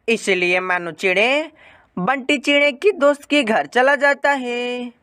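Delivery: plain reading voice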